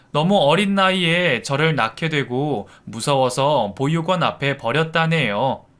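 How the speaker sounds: plain reading voice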